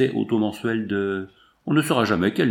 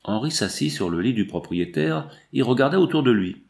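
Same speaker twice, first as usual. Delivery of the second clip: usual speaking voice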